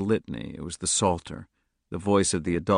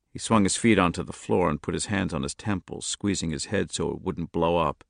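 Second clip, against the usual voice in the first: plain reading voice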